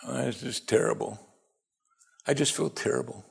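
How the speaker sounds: softly whining, hammed-up self-pity; a soft, mocking self-pitying whine. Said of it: "Pity party".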